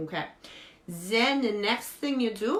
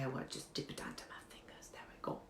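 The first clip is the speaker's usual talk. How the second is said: hushed whisper while dipping fingers; 5 semitones lower, 17 dB quieter, much faster than usual. Acted. Beauty host whispering as she dips into Tabasco. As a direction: whisper it as you work.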